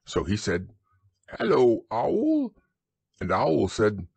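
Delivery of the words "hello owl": much higher, slow squeaky greeting; excited little kangaroo; squeaky Roo. Acted.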